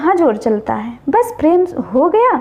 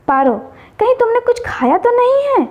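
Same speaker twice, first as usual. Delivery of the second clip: a touch of tearful alarm, pitched up; crying question; 7 semitones higher than usual. A narrator's reading. Paro, tearful, asks if he ate something. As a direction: Paro asks through mild tears. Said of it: "रोते हुए कहती है".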